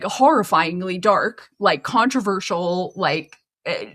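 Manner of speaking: usual speaking voice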